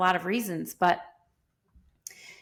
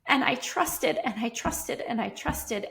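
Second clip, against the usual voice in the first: normal talking voice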